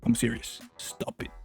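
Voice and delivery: ordinary delivery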